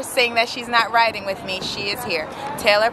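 ordinary talk

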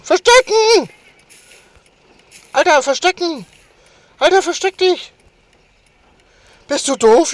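loud, high frantic shouting; panicked yelling. Real, not acted. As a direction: yell in panic to hide.

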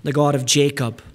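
ordinary talk

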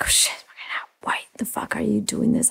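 hissed embarrassed whisper; urgent whispered scolding. Retold or imitated.

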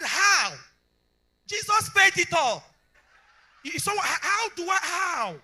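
loud, high, laughing exclamation; loud preaching with a laugh. Acted. Pastor telling the congregation of the shocking bill, laughing loudly. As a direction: shout it with a laugh of disbelief.

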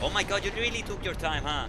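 loud, high mocking yell; angry sarcastic shout. Real, not acted. Sarcastic yelling at ally.

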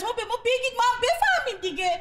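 shrill mock-pleading, laughing; high pleading demand. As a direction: needle them into confessing. Wife presses them to confess.

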